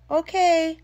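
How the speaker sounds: loud, high called reply; answering Mom with a loud call; yelled back. A narrator's reading. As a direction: shout it back across the house. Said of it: "I yelled back".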